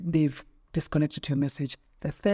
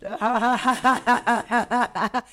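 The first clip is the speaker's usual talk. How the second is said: loud, high burst of laughter; long laugh; 6 semitones higher, 5 dB louder than usual. Real, not acted. Guest asked if she's in love, laughing loudly.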